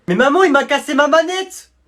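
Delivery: high, loud, indignant wail; shouting complaint to mum; outraged protest. Acted. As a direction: cry out that it is unfair.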